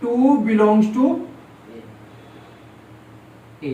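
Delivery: टू belongs to A read out very slowly, word by word; slow teacher dictation. Shown as a demonstration. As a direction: spell it out slowly for the class. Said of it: "read as".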